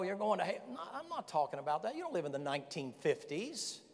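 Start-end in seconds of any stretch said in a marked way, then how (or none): 0.0-0.6 higher-pitched imitation of a condemning preacher; mildly acted street-corner damnation; mock ranting street preacher. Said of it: "screaming out on some kind of crazy".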